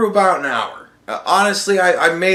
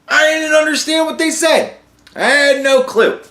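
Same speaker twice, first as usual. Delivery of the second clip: high-pitched, loud protest; exasperated bafflement; 12 semitones higher, 5 dB louder than usual. Real, not acted. American viewer who doesn't speak Spanish, exasperated and baffled. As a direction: plead total confusion, pitched way up.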